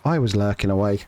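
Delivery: ordinary delivery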